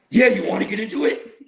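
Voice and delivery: can't keep it straight while quoting; laughing through the quote; laughing retelling. Retold or imitated. Friend's deadpan offer, laughing.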